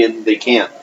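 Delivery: usual speaking voice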